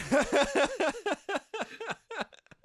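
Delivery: real, high-pitched laughing fit; high burst of laughter. Real, not acted. Friend retelling a film scene, real high laughter. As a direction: let the laugh break out high.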